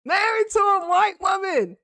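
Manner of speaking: can-you-believe-this delivery; incredulous repeat. Real, not acted. Repeats the line for incredulous emphasis.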